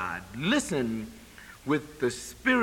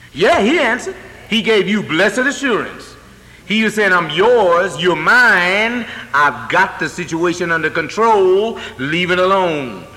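loud, high triumphant preaching; loud proclamation; 4 semitones higher, 9 dB louder than usual. Acted. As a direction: proclaim God's promise with booming assurance. God's reassurance proclaimed loudly.

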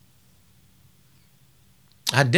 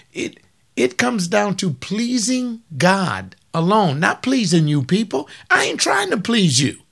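full-force preaching; 7 semitones higher than usual. Acted. Preaching climax with music.

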